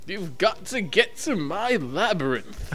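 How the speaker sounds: slow, lightly sung-songy Bowie voice with an English lilt; [name]. Retold or imitated. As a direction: drawl it out like Bowie in Labyrinth. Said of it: "in that certain Bowie style".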